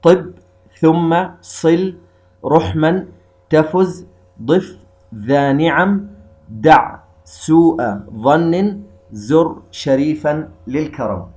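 the fourteen sun letters chanted slowly, word by word; measured teaching recital; slow mnemonic recital. Shown as a demonstration. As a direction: recite the verse slowly and clearly. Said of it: "وهي أربعة عشر حرفا".

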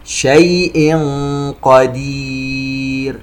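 very slow chant, hummed tanween in "شيء" before qaf; ikhfa recitation. Shown as a demonstration. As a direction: hum from "شيء" into a drawn-out "قدير". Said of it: "تنوين وبعده حرف القاف إخفاء". (ترتيل بإخفاء تنوين "شيء" ومد "قدير")

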